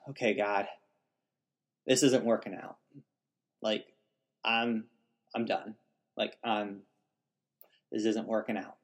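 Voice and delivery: hushed, weary plea to God; soft and worn out, giving up; quiet prayer. Retold or imitated. Desperate prayer retold in the tank.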